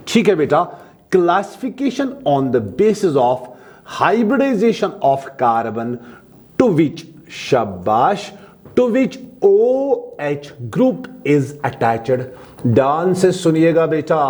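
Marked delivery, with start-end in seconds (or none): 1.1-12.3 the heading read word by word, slowly; slow dictation. Shown as a demonstration. Teacher dictates a heading for notes, slowly. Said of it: "dictation continues".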